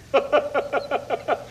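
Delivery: quick, high-pitched tittering laugh; high giggle. Acted. Director delighted at seeing Werner, high giggling. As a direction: giggle in a rapid even string.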